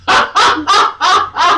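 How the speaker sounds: a high, loud burst of laughter. Real, not acted. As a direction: let out a big squealing laugh.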